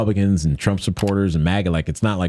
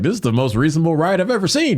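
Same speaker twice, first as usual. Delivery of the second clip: mock-amazed, higher-pitched quip; joking; 10 semitones higher than usual. Speaker joking on his film about the riot, joking. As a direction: re-enact a playful announcement to the camera, pitched up. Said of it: "like, kinda joking".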